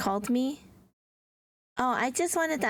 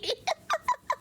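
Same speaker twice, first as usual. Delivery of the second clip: 1 high giggle. Real, not acted. Laughing at own joke.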